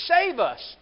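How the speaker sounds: loud, high cry for rescue; exclaimed plea. Acted. Matthew begging Jesus for rescue, exclaimed shout. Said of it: "Exclamation point".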